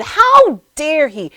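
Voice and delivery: slow, loud, indignant "How dare he?"; fuming. Retold or imitated. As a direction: drag it out in outrage. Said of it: "I'm fuming".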